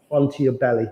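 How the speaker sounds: usual speaking voice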